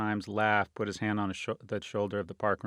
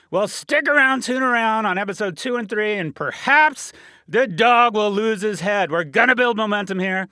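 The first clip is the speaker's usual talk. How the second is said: hyped sign-off; 11 semitones higher, 10 dB louder than usual. Real, not acted. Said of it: "hype sign-off".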